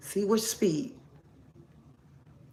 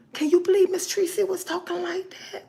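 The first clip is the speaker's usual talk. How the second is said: very high, hushed mimicking voice; mock a shocked gossip in a high little voice; scandalized gossip; 12 semitones higher, 4 dB quieter than usual. One viewer gossiping to another about Miss Tracy, high scandalized voice.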